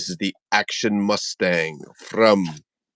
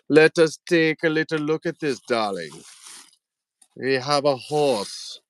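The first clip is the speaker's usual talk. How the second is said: raised, put-on German accent; bad German accent; 7 semitones higher than usual. Host shows off toy horse, bad German accent. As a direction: do a hammed-up, poor German accent. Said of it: "My German accent sucks".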